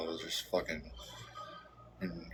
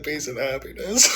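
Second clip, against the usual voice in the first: laughing while quoting; 7 semitones higher, 6 dB louder than usual. Retold or imitated. Son retells dad's wish to viewers, laughing and high. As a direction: quote it high through laughter. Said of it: "He said".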